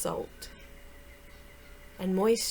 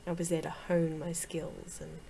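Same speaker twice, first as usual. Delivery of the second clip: ordinary talk, nothing marked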